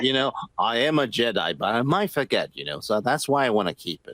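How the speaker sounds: lightly voiced Jedi, half-hearted; mock Obi-Wan. Mocking Obi-Wan's excuse in character.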